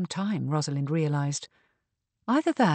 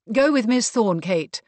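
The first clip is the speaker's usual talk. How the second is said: louder, higher order; commanding; 5 semitones higher, 7 dB louder than usual. A narrator's reading. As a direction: settle it with a brisk command. Mariana settles the issue with orders.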